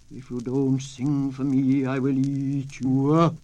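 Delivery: slow, loud coyote threat; menacing coyote. A narrator's reading. Coyote threatening to eat the turtle, slow and loud. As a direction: threaten him slowly and loudly.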